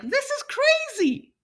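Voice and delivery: very high, slow, loud incredulous squeal; ridiculous tone. Shown as a demonstration. Student speaker demonstrating a ridiculous tone, high-pitched and disbelieving. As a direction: squeal it out as if it's absurd. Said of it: "I changed the pitch of my voice to make it sound like I think this is crazy".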